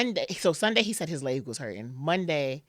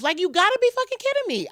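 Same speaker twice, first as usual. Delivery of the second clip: much higher and louder, shouted disbelief; furious disbelief; 7 dB louder than usual. Retold or imitated. Raging at fate over his death, high loud outburst. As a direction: yell it at the universe. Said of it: "I was so mad".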